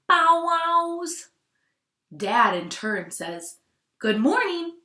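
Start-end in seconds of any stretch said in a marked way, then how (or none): 0.1-1.3 high, loud barking bow wows. A narrator's reading. Teacher voicing Mom's loud barks at the dog. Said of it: "Mom greets Fabio with a couple loud". 4.0-4.8 a touch of a barking dad; half-bark the greeting; doglike greeting. A narrator's reading. Teacher voicing Dad greeting the dog like a dog. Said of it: "his absolute best impression of a dog".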